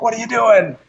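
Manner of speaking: high-pitched imitation of an outraged team; mock screaming. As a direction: mimic colleagues yelling in disbelief, pitch jumping up. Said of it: "the team was scream-, screaming at me afterwards".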